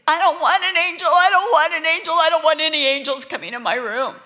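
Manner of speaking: put-on high, loud, frantic little-girl plea; panicked child. Little [name] runs to her brother begging, high and loud in panic. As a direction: cry it out like a terrified six-year-old. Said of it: "So I went running back to Chuck and said".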